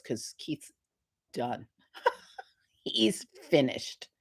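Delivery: soft, low, whispered gossip; whispered aside, laughing; hushed aside. Retold or imitated. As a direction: drop to a secretive near whisper.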